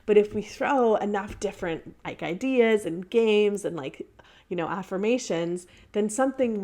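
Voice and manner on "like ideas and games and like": ordinary talk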